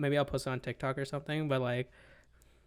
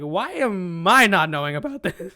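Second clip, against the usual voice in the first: very loud, slow, higher-pitched complaint; indignant protest; 4 semitones higher, 13 dB louder, slower than usual. Retold or imitated. Kid retelling a complaint to mom about the neighbor's cat, indignant. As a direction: protest loudly, stretching the words.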